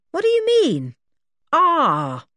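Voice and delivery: high, loud, puzzled question; baffled challenge. A narrator's reading. Dick questioning the policeman's reply, baffled. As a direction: throw the word back, bewildered.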